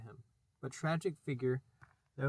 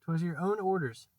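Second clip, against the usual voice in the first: faltering; 4 semitones higher, 6 dB louder than usual. Acted. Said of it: "the boatswain faltered".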